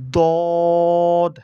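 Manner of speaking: "দদ" loud, rounded, vowel held long; demonstrated Arabic letter. Shown as a demonstration. Teacher models the letter, loud drawn-out "দদ". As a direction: round and stretch "দদ" forcefully. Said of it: "এটা কীভাবে করতে হবে".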